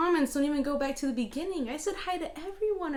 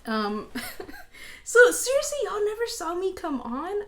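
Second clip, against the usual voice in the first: normal talking voice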